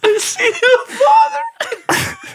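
squealed through laughter; high laughing shriek. Retold or imitated. Squealing mock of friend's line.

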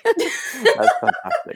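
high, slow laughing words; squeezed out through laughter. Real, not acted. Speaker cracks up at pig story, laughing high and slow.

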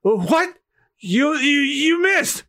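high, stammering disbelief; astonished pirate. Acted. Pirate seeing that the bullets missed, astonished. Shooter stunned by the miss.

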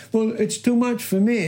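high, whiny-style objection; mock complaint. Preacher mimics a believer balking at discipline, mock complaint. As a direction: imitate someone protesting it's too hard.

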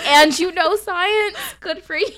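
high, loud and drawn-out retold praise; gushing mock amazement. Praising the drunk guy at the bar, loud gushing re-enactment. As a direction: exclaim it as if hugely impressed.

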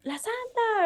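mock high-pitched fan calling out; imitated fan call. Fans calling out to her.

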